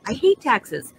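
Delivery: usual speaking voice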